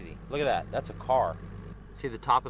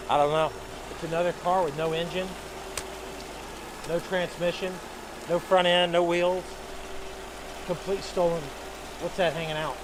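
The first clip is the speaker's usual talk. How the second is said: excited; 6 semitones higher than usual. Real, not acted. Watching divers find stolen car.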